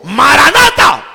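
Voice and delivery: huge, high, drawn-out shout. Shown as a demonstration. Preacher shows the congregation why he raises his voice, full shout. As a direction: bellow it slowly into the microphone. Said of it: "si tomo el micrófono y le digo".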